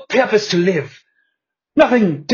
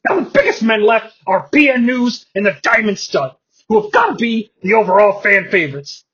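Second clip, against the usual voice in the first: ordinary delivery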